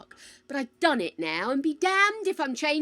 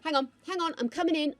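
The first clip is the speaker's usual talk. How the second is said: normal talking voice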